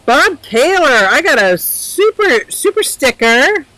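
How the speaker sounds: high, loud, gushing thanks to a viewer; excited thanks. Real, not acted. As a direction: squeal your thanks for the gift. Excited thanks for sticker gift.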